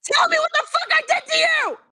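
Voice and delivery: sobbing, furious demands; crying shout. Real, not acted. Girlfriend demanding answers, shouting and crying. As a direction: yell it through tears.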